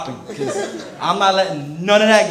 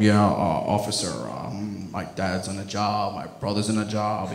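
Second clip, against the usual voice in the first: meek excuse; 10 semitones lower, 8 dB quieter than usual. Retold or imitated. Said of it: "I threw the, um".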